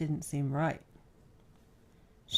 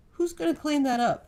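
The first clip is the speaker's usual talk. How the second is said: higher, louder fake-stern question; mock seriousness; 8 semitones higher, 4 dB louder than usual. A narrator's reading. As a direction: play at being stern, teasing. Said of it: "Darius asked with mock seriousness".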